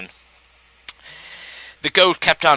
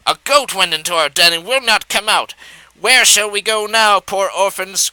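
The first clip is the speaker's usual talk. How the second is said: crying out; 6 semitones higher than usual. A narrator's reading. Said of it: "cried Miss Fox".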